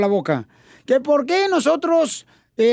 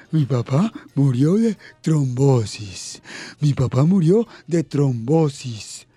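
put-on much lower, slow dopey boy; dopey kid voice; 11 semitones lower, slower than usual. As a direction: play the dim student, low and slow. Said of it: "Dice el Babalucas".

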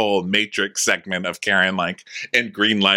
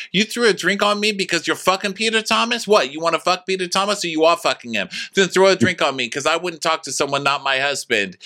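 higher indignant rant building to insults; ranting Wendy; 8 semitones higher than usual. Retold or imitated. Wendy ranting at Mia about Peter Thomas, high mock rant.

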